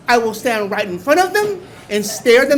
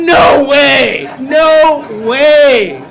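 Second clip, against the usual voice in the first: loud, astonished no way; excited shout; 3 semitones higher, 10 dB louder, much slower than usual. Retold or imitated. Amazed driver shouting at a bumper sticker, loud excitement. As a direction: shout it out in disbelief.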